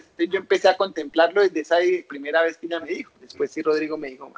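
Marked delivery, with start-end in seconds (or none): none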